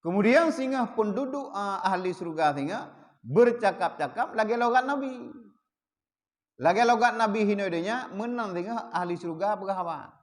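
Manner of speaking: ordinary delivery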